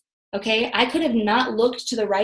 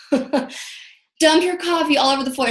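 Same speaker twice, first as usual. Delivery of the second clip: laughing; 5 semitones higher, slower than usual. Real, not acted. Laughing retelling the spill.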